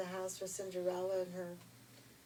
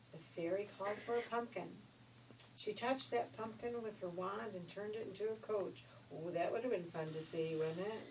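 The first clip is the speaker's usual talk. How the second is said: usual speaking voice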